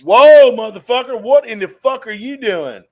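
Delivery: indignant outburst. Retold or imitated. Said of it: "And I'm like".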